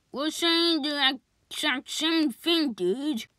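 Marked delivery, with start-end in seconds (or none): none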